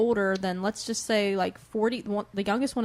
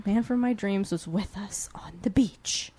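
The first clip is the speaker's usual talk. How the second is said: hushed reading of the line; whispered reading; slower than usual. Shown as a demonstration. Reading the book's sentence aloud to the co-host, whispered. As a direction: read it in a hushed breathless whisper. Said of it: "reading the actual sentence".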